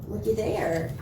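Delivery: ordinary delivery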